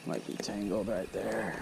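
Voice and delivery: hushed whisper to himself. Real, not acted. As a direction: whisper it under your breath. Quiet aside while fishing.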